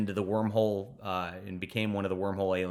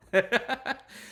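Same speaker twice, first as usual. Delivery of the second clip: short high laugh; 12 semitones higher than usual. Real, not acted. Laughs greeting the editor.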